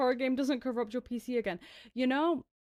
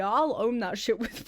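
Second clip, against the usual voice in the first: ordinary delivery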